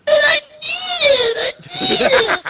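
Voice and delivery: put-on high, crying whiny child voice; whiny kid. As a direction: wail it like a spoiled kid. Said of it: "impression of a whiny kid".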